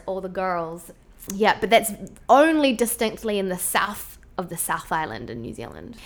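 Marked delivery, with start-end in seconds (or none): 0.1-0.9 put-on all the girls with a strong R in girls; softer demo of girls with a Southland R; rolled R. Shown as a demonstration. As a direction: sound the R in girls, almost American. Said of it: "the R's".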